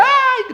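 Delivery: very high, loud mock shriek; piercingly high imitated "ay" scream. Imitating the possessed person screaming as he passes, mock shriek.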